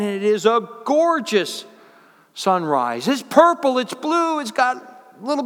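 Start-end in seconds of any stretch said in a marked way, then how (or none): none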